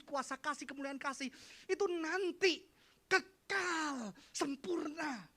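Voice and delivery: high, loud, emphatic teaching; full-force preaching. Acted. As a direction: drive each point home loudly.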